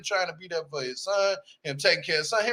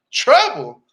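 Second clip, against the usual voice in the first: louder, unbelieving echo; incredulous; 2 semitones higher, 8 dB louder than usual. Real, not acted. Vlogger reacting to the dad, incredulous. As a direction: spit the word back in disbelief.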